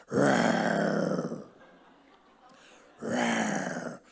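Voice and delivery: little boy's pretend bear growl, first one loud, second breathed out; playful kid growl, the first one loud; mock bear growl. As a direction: growl like a four-year-old playing a bear. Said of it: "he was growling at people".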